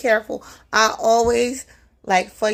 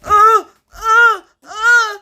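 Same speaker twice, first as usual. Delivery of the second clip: much higher, much louder panicked stammer; terrified stammer; 11 semitones higher, 10 dB louder, much slower than usual. Retold or imitated. Gas station attendant too scared to speak, high panicked stammer. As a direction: choke on the words in terror. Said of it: "he couldn't talk".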